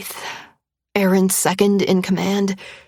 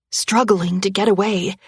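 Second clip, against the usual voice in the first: plain reading voice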